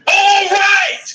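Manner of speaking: high, loud, fed-up yell; exasperated shout. Acted. Investigator fed up with the scientists' jargon, exasperated shout. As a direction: cut them off with a frustrated shout. Exasperated outburst during a briefing.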